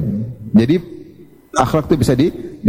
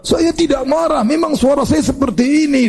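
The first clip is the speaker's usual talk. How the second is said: high, drawn-out indignant protest; hammed-up angry self-defence; mock angry denial; 9 semitones higher than usual. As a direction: play someone insisting they're calm in a raised, high voice. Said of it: "itu namanya marah".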